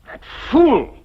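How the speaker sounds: loud bitter outburst; furious at the husband; angry. Acted. Angry outburst about rival.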